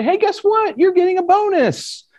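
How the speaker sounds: very high-pitched, gushing fake good news; mock excitement. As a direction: announce a bonus with hammed-up glee. Voices fake bonus phishing email.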